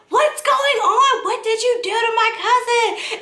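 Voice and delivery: high, indignant mock howl; howling down steps. Cousin to the man down the steps, howling. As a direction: call it out high, like an accusation from upstairs. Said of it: "howls down the steps".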